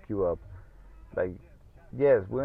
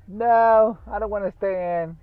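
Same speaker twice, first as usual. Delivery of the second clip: high, whiny imitation of the wife; husband mocking his sick wife, high and whiny; 13 semitones higher, 10 dB louder than usual. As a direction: mimic a stubborn wife refusing. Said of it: "You know how fucking girls are".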